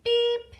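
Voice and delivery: high, loud toy-car beep; playful beep. Shown as a demonstration. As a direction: chirp it like a toy button. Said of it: "likes to go beep".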